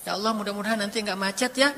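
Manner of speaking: understated soft prayer; casual little prayer. Acted. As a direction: ask God gently, in confidence. Models a small prayer to God.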